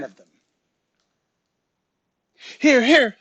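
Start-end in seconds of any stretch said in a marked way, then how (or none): 2.6-3.2 high, loud raspy call; raspy, raised voice calling out. A narrator's reading. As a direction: rasp it out, pitched up and loud. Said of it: "Third said in a raspy voice".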